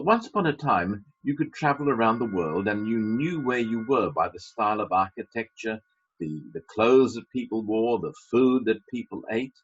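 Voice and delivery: ordinary talk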